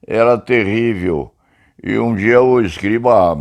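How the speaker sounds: ordinary talk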